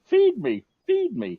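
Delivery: very high, loud squeaky plea to be fed; hungry headset voice. Retold or imitated. Headset begging for a charge in a squeaky high voice. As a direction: squeal it like a starving gadget. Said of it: "my headset was saying".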